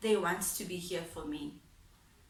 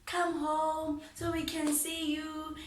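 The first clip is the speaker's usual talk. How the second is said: mother begging; 7 semitones higher than usual. Retold or imitated. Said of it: "she would beg".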